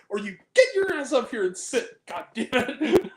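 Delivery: hammed-up rant at a friend with laughter; mock angry scolding. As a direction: scold your friend half in jest. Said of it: "And I was so pissed".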